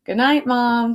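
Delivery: loud call to Mom; calling out goodnight. A narrator's reading. D W calls goodnight down to Mom, loud. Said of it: "called D W".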